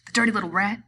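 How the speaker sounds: loud, indignant outburst; angry cry. A narrator's reading. Slim jumping up in outrage at Curly. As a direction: spit it out in disgust. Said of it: "he cried".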